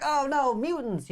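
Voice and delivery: hammed-up alarm; mock panic. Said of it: "I'm like".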